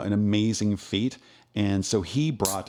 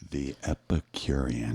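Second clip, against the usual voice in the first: low, slow, drawn-out announcer read; 8 semitones lower, 4 dB quieter, slower than usual. Shown as a demonstration. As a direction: stretch the name out like a promo. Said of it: "that's too slow".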